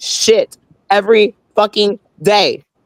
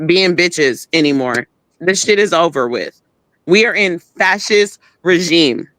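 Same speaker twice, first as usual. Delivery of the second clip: ordinary talk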